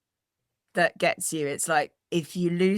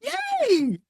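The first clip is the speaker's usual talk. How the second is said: excited cheer. Real, not acted. Learner finishes phrase.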